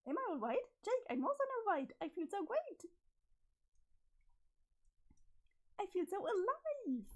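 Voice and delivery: high, giggly and unsettlingly sweet; high-pitched creepy cheer; high manic Jane. Acted. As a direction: soothe him while sounding unhinged. Jane insists she's great.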